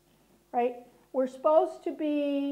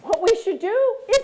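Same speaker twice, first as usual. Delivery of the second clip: firm, direct statement; confident man; 6 semitones higher, 8 dB louder than usual. Shown as a demonstration. Men in the meeting stating it with certainty. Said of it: "And the men would say".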